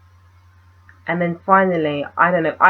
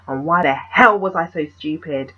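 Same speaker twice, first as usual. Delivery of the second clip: normal talking voice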